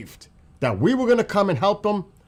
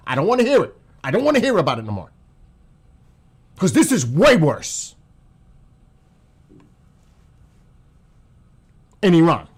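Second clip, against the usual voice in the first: louder, higher, furious insistence; real anger; 4 semitones higher, 5 dB louder than usual. Real, not acted. Ranting commentator refuses to hear excuses, real fury. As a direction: hammer it home, fed up and furious. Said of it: "I'm so pissed".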